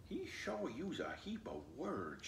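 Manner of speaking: soft, hushed aside to the cat; whispered aside. A narrator's reading. Flossie confides to the cat in a soft hush. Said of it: "she whispered".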